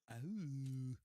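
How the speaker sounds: loud, drawn-out "oh"; pained giant groan. Acted. Drone flies into giant's eye, loud acted groan.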